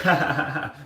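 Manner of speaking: mock coworker's chuckle; imitated laugh. Coworker laughing off the complaint, imitated laugh. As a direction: act out his brush-off laugh. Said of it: "he would be like".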